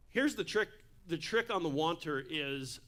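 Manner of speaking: normal talking voice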